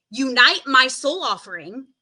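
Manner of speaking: normal talking voice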